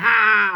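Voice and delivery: a hammed-up yelp of panic; crowd yell. A narrator's reading. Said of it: "everyone's yelling".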